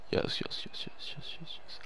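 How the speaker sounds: soft breathless chant of yes; whispered yes. Real, not acted. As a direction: celebrate under your breath. Quiet celebration under breath.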